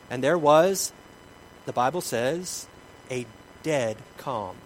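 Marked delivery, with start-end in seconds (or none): none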